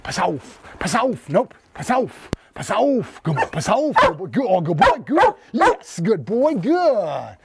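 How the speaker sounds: high-pitched commands bursting into praise; short, excited calls to a dog; excited dog coaxing. Real, not acted. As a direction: work the dog up to bark, then praise it. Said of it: "talked the dog in with a little excitement".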